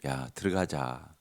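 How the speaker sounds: soft, slow soothing call; coaxing father. Retold or imitated. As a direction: gently coax the son to come inside. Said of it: "아버지가 나와서 달래는 거예요". (부드럽게 달래는 아버지)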